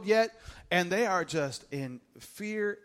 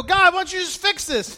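high, loud pleading whine; whiny complaint; 11 semitones higher, 8 dB louder than usual. Acted. Preacher complaining to God about life's junk, high loud whine.

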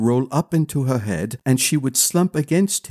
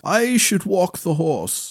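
higher, slow servant voice; old retainer; 7 semitones higher than usual. A narrator's reading. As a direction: offer dutifully.

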